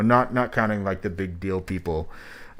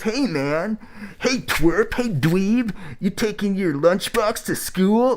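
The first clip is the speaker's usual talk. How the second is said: much higher, taunting mock-bully sneer; eighties bully voice; 10 semitones higher, slower than usual. Reviewer parodies the rapper's tone as a high taunting bully. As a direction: play a cartoon school bully jeering at a nerd. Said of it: "It's the eighties bully voice".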